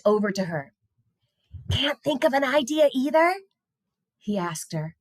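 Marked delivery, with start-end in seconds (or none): 1.7-3.4 put-on a touch higher, friendly boy; mildly voiced young boy asking; lightly voiced boy. A narrator's reading. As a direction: lean over and ask a fellow kid. Caleb character voice.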